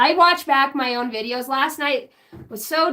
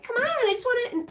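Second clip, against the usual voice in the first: whiny plea; 9 semitones higher, 5 dB quieter, faster than usual. Retold or imitated. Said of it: "And I'm like".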